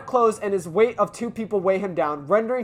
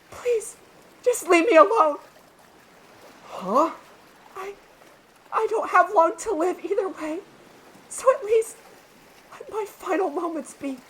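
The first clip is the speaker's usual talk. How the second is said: Hitoa's fragile voice; 11 semitones higher than usual. Acted. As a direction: let the voice thin out into resignation. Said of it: "I can't do her voice anymore".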